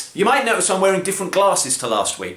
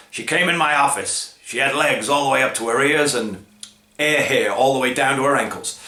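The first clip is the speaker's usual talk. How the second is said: lightly voiced grizzled detective; hint of a hard-boiled narrator; noir detective; 2 semitones lower than usual. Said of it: "an old-style grizzled detective".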